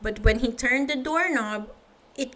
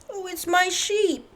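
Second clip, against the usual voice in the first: higher, worried farmer voice; 8 semitones higher than usual. Acted. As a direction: fret like an anxious old farmer. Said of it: "said Farmer Fields".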